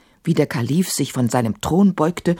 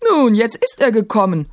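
put-on higher-pitched old grandmother, dry retort; raised-pitch matriarch voice; critical grandmother; 6 semitones higher, 4 dB louder than usual. A narrator's reading. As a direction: play a critical grandmother cutting in. Grandmother's character voice.

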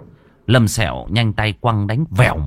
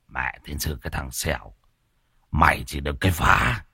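low, soft scolding; tired, low-voiced reproach; weary grumbling curse; 6 semitones lower, 6 dB quieter than usual. A narrator's reading. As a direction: grumble under your breath, worn out. Worn-out man grumbles after fire.

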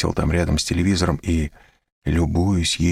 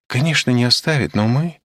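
higher and louder, insistent; emphatic; 8 semitones higher, 3 dB louder than usual. A narrator's reading. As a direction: press the point hard. Olga insists, then breaks off. (настойчиво)

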